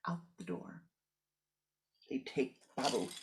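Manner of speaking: usual speaking voice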